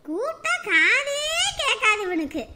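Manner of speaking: high, drawn-out exasperation; exasperated. Acted. Mother grumbling that her son never hears, exasperated. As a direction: complain aloud, stretching the first words.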